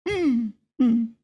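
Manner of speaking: high nasal-free hum of agreement; mock high humming. Wife knowingly agreeing with the pastor, mock high humming.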